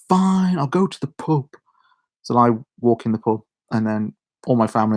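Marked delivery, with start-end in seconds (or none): 0.1-1.5 reluctant giving in. Retold or imitated. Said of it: "I'm like".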